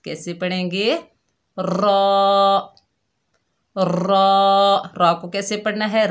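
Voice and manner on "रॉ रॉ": रॉ held one alif long, full-mouthed; trilled, heavy रॉ; heavy raw. Shown as a demonstration. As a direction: round the रॉ and stretch it. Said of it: "एक अलिफ की मिकदार लंबा करके पढ़ेंगे".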